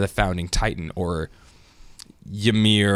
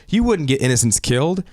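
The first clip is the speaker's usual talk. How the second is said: mildly impassioned pleading, pitched higher; Mikasa; 6 semitones higher than usual. Retold or imitated. Imitating her appeal to Aaron, somewhat passionate and higher. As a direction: plead with growing feeling, lightly acted. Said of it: "she starts to get passionate".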